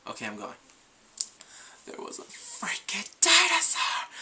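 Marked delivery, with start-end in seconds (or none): 1.8-4.1 stage-whispered excitement, higher; hushed thrilled reveal, slow; excited. Real, not acted. Vlogger revealing the dinosaur surprise, whispered excitement. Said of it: "excited reveal".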